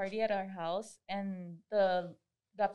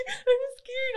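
very high, whimpering imitation of a scared boy; hammed-up tearful fright; mock crying kid; 5 dB louder than usual. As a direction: squeal it like a crying child, through laughter. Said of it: "he's crying".

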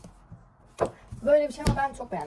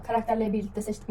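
ordinary talk, nothing marked

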